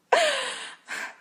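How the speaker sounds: short high weak laugh; a tired breath of laughter. Acted. Exhausted Rachel laughing weakly. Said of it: "Rachel laughed weakly".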